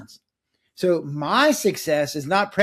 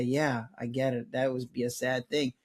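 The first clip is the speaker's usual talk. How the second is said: soft, lower consoling voice; gentle empathy; 4 semitones lower, 9 dB quieter than usual. Retold or imitated. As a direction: console a grieving patient, softly and low. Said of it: "thin enough that I could say".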